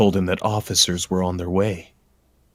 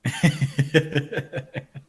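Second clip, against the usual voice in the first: real laughter; 7 semitones higher, 6 dB quieter than usual. Real, not acted. Laughs at own story.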